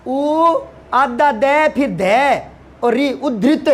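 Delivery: उद्धृत built up sound by sound, very slow; spelled out slowly. Shown as a demonstration. Teacher scolding a student, spelling out उद्धृत slowly. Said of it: "अरे बावड़े".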